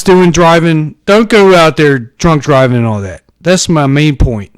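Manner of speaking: usual speaking voice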